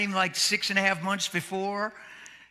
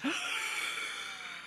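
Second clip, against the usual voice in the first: imitated gasp; 8 dB quieter than usual. Wife's shock retold.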